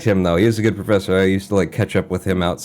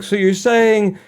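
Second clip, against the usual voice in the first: high, slow, exaggerated innocent question; high slow mock-innocent student voice; mock earnest student question; 9 semitones higher than usual. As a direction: ask it wide-eyed and innocent. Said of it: "shot my hand up and was like".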